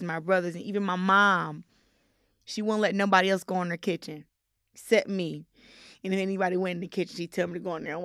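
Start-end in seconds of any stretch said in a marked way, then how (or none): none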